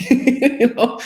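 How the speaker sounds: a bright laugh breaking into the words. Real, not acted. Laughing at her own odd view of the world. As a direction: let a laugh carry the question up high.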